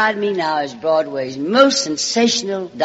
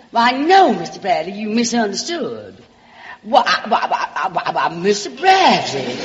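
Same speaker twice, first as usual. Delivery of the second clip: Southern belle voice; 4 semitones higher than usual. Acted. Alabama girl sweet-talks the producer's secretary, in a Southern belle voice. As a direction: play the small-town Alabama charmer calling everyone sugar and darling.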